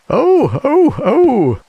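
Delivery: very high loud oh oh oh screams; shrill steam-engine scream; screaming shout. A narrator's reading. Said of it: "shouted the Queen".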